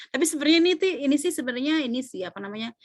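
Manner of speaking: usual speaking voice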